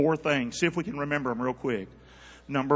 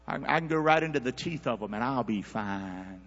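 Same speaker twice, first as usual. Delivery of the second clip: normal talking voice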